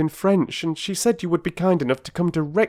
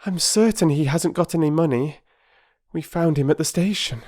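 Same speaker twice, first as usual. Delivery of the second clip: put-on much higher, louder hurried girl's voice; breathless Bobby; 11 semitones higher, 4 dB louder than usual. Bobby breathlessly telling the doctor about the Russian, high voice. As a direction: rush the news out.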